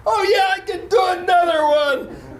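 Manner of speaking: very high, loud triumphant shout; hammed-up exuberant yell; acted burst of energy. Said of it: "suddenly I'm filled with energy".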